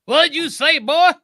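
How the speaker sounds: loud, high Yosemite Sam-style Southerner; mock Southern accent. Joining in on the accent joke, loud mock Southern. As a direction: holler it in a cartoon Southern accent. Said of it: "like, you, the Yosemite Sam".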